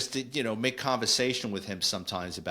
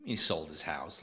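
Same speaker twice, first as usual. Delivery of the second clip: quiet aside; 6 semitones lower, 6 dB quieter than usual. Acted. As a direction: lower and softer, muttered to himself. Repeating the news in disbelief.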